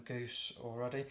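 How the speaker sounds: normal talking voice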